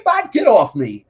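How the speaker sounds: loud, high plea to get off; retold shout. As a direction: shove them off with a loud high cry. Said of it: "I was like".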